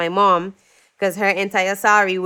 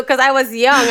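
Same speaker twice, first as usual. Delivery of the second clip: ordinary delivery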